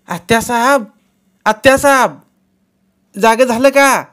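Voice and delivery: high, loud calling out; calling to wake. A narrator's reading. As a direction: wake the old lady by calling. Daughter-in-law wakes her.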